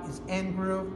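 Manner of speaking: ordinary delivery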